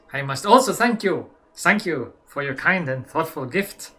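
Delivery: light mock German accent, reading the doctor's letter loudly; hint of a German accent. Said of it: "let me do my best German".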